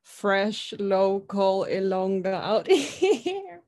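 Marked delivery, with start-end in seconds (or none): none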